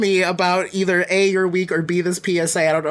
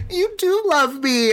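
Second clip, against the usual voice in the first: high, drawn-out imitation of a friend; slow, high mock-emotional gush; touched, gushing; 9 semitones higher, slower than usual. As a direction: imitate a friend melting with affection. Retold teasing by tearful friend.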